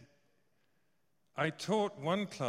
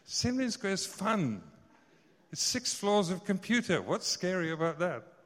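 higher and louder, playfully indignant; excited protest; 6 semitones higher, 6 dB louder than usual. Real, not acted. Preacher protesting to the congregation, high and loud. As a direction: burst out defending the place.

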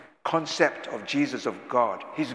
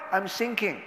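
fearful Peter; 5 semitones higher than usual. Acted. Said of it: "He was so fearful".

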